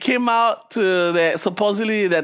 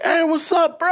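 high-pitched, familiar bar greeting; eager greeting; 9 semitones higher than usual. Retold or imitated. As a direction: greet like an old buddy, pitched up. Stranger greets him at bar.

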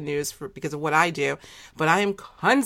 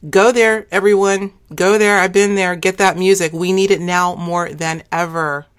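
emphatic urging; 2 semitones higher, 8 dB louder than usual. Real, not acted. Host urging everyone to go get the guest's music, loud and emphatic. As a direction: call it out loudly, rallying everyone.

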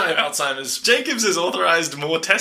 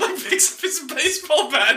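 ordinary talk, nothing marked

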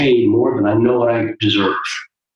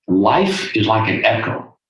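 ordinary talk, nothing marked

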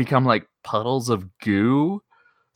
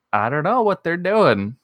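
usual speaking voice

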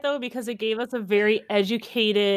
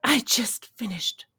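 slow whispered start, last word louder; breathless whisper; 3 dB louder, slower than usual. Retold or imitated. Mom on a video call confiding the show in a whisper. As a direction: whisper it like a scandalous secret.

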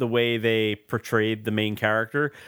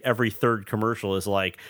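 normal talking voice

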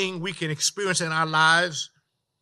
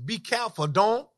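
ordinary delivery

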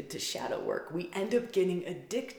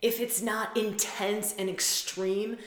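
ordinary delivery

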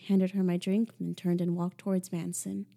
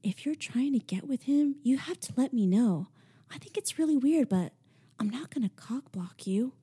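higher, hushed confiding friend; lowered voice; 6 semitones higher than usual. A narrator's reading. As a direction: lean in and keep it between you. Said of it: "she lowered her voice".

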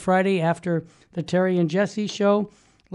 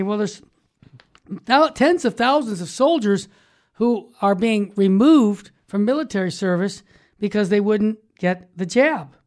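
usual speaking voice

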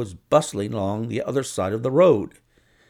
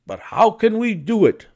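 higher, eager question; raised questioning voice; 10 semitones higher, 5 dB louder than usual. A narrator's reading. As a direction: ask it keen and bright. Watson asks, raised voice.

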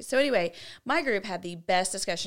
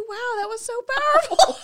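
mock awe; 13 semitones higher, 5 dB louder than usual. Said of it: "You were supposed to say".

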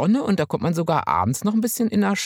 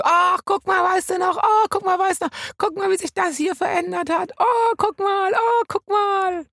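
excited. Retold or imitated. Excited reminiscing on Hamburg tour.

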